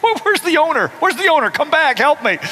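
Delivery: high, frantic call for help; mock panic. [name] calling for the owner in mock panic. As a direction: cry out for the owner like a scared kid.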